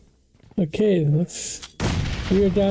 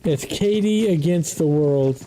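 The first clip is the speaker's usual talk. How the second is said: ordinary delivery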